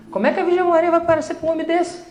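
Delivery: how could she appear to such a man; exaggerated astonishment; incredulous. Incredulous rhetorical question.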